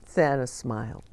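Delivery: usual speaking voice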